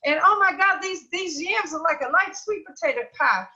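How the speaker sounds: imitating a friend raving nonstop; gushing excitement. Friend telling how she called relatives, high loud gushing. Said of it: "She said".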